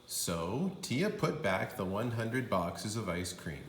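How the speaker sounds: ordinary delivery, nothing marked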